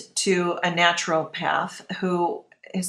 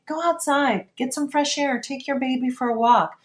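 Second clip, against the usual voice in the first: mock nurse; 7 semitones higher than usual. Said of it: "she would say".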